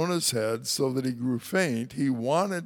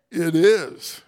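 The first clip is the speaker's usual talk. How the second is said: loud, indignant retort; 3 semitones higher, 9 dB louder than usual. Acted. Jonah answers God's question with a loud indignant retort. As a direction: snap it back at God.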